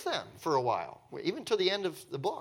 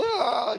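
high, loud blubbering "ah"; hammed-up wail of a total mess; mock wail; 9 semitones higher, 6 dB louder than usual. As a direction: imitate someone falling apart. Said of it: "a hot mess".

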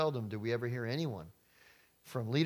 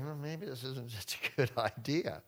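doubtful; 4 dB quieter than usual. Pretend. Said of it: "they're starting to say".

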